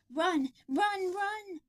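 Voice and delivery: urgent high shout; calling out. Acted. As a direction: yell for everyone to flee. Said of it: "Michael called".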